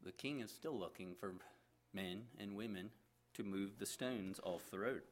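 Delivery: lively read-aloud storytelling voice with acted characters; story narration. A narrator's reading. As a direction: tell the story to the listeners. Reads a story aloud to congregation.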